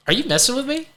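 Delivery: can't believe what he's hearing; incredulous disbelief. Retold or imitated. Stunned at counselor's suggestion.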